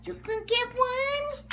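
excited dog-talk pitch; high sing-song. Acted. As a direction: lift it way up for the dog. Teasing the dog with cookie.

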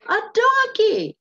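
"A donkey" pitched high and loud; emphatic exclamation. Shown as a demonstration. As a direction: really punch "A donkey" as an exclamation. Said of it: "we want to put some emphasis".

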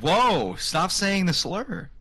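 high, drawn-out mock outrage; mock offended. Friend objecting to a banned word, mock offended. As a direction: stretch it out in playful offence.

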